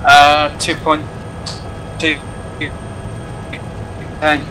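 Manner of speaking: broken-up radio transmission; cutting out over the radio; crackly military radio. Real, not acted. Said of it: "transmission broke up".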